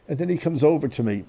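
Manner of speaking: usual speaking voice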